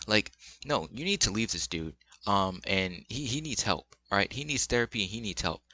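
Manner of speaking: ordinary delivery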